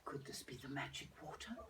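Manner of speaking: teary whisper; hopeful whisper. Acted. Old lady whispering hopefully at the pool. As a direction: whisper it, hardly daring to hope.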